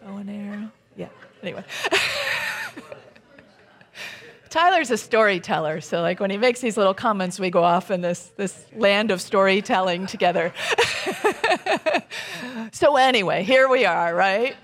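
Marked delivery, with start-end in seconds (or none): 1.7-2.9 high-pitched real laugh. Real, not acted. Storyteller amused by an audience comment, real high laugh. 10.6-12.7 long high giggling laugh. Real, not acted. Storyteller amused by going off on tangents, real high laugh.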